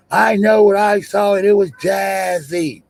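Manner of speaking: high, slow, drawn-out mock claim; mocking imitation. Streamer mocks a claimed sighting, imitating the witness. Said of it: "How the fuck you seen Jazzy?".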